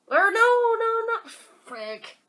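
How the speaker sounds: high loud frustrated cry; dismayed shout. Real, not acted. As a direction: yelp at the misclick. Misclick dismay.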